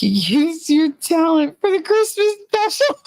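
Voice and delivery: high, laughter breaking through the words; laughing. Real, not acted. Teasing Nerd about the Christmas special, laughing. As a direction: barely get it out through laughter.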